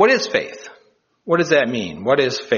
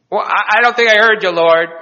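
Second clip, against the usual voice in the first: high, loud, hurried doubt; hammed-up alarmed hesitation; nervous balking; 9 semitones higher, 6 dB louder than usual. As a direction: stammer back up in disbelief. Cliff hanger balks at the order.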